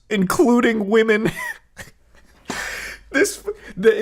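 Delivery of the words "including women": "including women" lifted high and pointed; sarcastic, high emphasis on "including women"; mocking stress. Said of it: "the biggest red flag".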